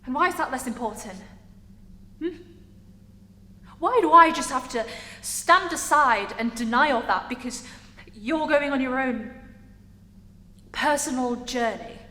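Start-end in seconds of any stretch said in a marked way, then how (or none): 3.8-7.7 loud, heavily stressed protest; indignant protest. Acted. Character refusing to stand aside, indignant. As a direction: push the question out forcefully.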